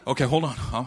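normal talking voice

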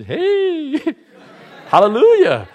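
high, laughing mock praise; fake cheerful hallelujah. Sad churchgoer faking joy with a laughing hallelujah. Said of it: "you come to".

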